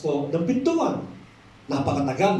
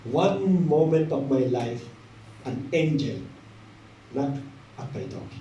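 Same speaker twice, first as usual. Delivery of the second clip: slow, low, softening retelling; solemn testimony; 5 semitones lower, 4 dB quieter, slower than usual. Retold or imitated. Old man tells pastor of his visitor, solemn and subdued. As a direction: recount it gravely, sinking quieter toward the end.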